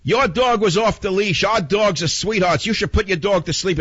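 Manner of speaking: high, shrill-pitched mock of angry women yelling; hammed-up imitation of trashy girlfriends yelling; mocking screaming girlfriends. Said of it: "And they scream at me".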